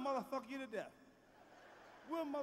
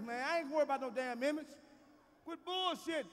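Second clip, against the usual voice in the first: ordinary talk, nothing marked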